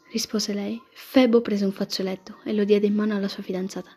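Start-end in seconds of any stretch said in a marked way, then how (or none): none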